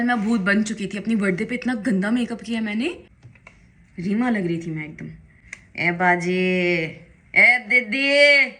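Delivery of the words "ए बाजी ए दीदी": drawn-out loud call across the house. Real, not acted. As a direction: call out slowly and loudly to someone far off. Calling out to someone in house.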